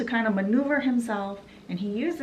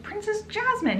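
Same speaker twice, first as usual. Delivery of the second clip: shocked exclamation; 14 semitones higher than usual. Retold or imitated. Guard shocked at discovering princess.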